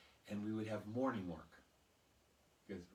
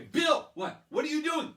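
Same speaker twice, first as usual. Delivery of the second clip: higher, louder bewildered teacher; exasperated teacher; 15 dB louder than usual. Retold or imitated. Teacher catching the boy running around, high and loud. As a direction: snap at the boy in disbelief.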